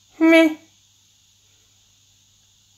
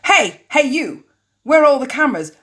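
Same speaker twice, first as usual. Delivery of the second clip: calling out; 7 semitones higher, 6 dB louder than usual. Acted. Woman shouts at the security guard, acted calling out. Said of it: "shouts at the security guard".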